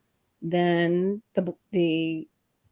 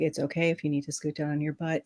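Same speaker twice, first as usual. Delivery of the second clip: quick, softer, level monotone; imitating a calm crisis-mode voice; monotone reassurance; 7 dB quieter, faster than usual. Firefighter talks panicking skier down, monotone. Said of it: "kept that monotone voice with me".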